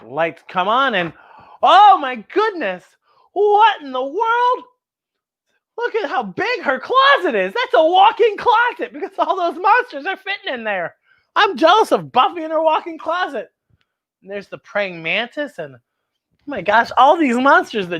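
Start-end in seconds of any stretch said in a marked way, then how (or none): 1.6-13.5 amazed. Acted. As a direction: marvel at the huge closet. Reader amazed at monster closet.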